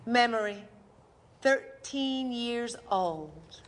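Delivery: slow, measured title read; stage heading, slow and even; announcer heading. Acted. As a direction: announce the memory slowly. Memory heading.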